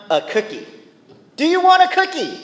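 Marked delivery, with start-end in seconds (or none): 1.4-2.4 much higher, louder sarcastic taunt; sarcastic jab. Shown as a demonstration. Preacher voicing a sarcastic taunt to the congregation, high and loud. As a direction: throw the line out mockingly.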